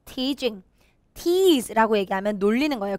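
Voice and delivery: normal talking voice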